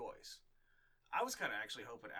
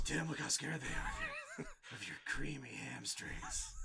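creepy Batman voice; 7 semitones higher, 3 dB louder, slower than usual. Acted. Creepy Batman praising Tim.

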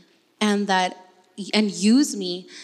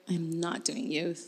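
soft, slow flat no; deadpan refusal; 4 semitones lower, 11 dB quieter, slower than usual. Retold or imitated. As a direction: mutter the refusal slowly. Refusing youth ministry, retold.

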